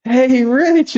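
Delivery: high, playful mock call. Friend calling up to a grounded kid's window, high call. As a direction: imitate a friend calling from outside. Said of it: "I heard someone going".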